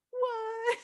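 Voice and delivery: high, quiet, incredulous what; retold disbelief. Recalling getting her period after expecting pregnancy, retold disbelief. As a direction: squeak out a soft, stunned what. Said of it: "I'm like".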